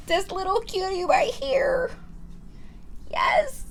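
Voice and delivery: cutesy voice. Pretend. Cooing over a cute item.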